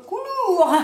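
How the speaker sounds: COULOUR high, loud and drawn out, laughing; hammed-up mispronunciation. Vlogger stumbling on the English product name, hammed up. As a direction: belt out a mock-fancy COULOUR.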